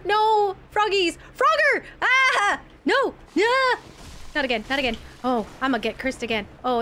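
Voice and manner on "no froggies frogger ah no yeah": high loud frantic shrieks; real panic, yelled; panicked screaming. Real, not acted. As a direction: scream as the frogs return. Frogs attack again.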